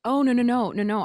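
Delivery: loud, high rush of no's; emphatic reassurance. Real, not acted. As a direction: wave the apology away loudly. Waving away the host's apology.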